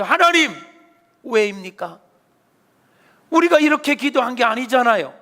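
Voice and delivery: loud, high, anguished accusation; angry protest to God. Acted. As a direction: cry out at God, demanding why. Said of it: "따지듯이".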